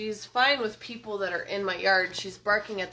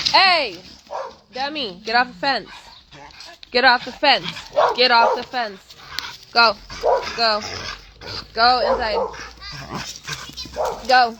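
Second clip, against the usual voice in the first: loud repeated commands; yelling at dogs; 4 semitones higher, 9 dB louder than usual. Real, not acted. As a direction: shout the dogs inside. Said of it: "I'm gonna go yell at the dogs".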